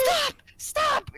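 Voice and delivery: high, short mock scream; retold scream. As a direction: imitate a patient screaming to stop. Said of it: "screaming from the top of his lungs".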